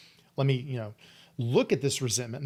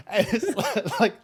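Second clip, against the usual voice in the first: real laugh, slowed and higher; 8 semitones higher, 4 dB louder, slower than usual. Real, not acted. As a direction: laugh at your own confession. Laughing at his own story.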